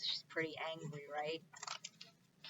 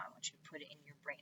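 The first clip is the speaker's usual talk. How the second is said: fast whisper; 3 semitones lower, 7 dB quieter, much faster than usual. Shown as a demonstration. Teacher asking pupils to imagine, fast whisper. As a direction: whisper it quickly to the class.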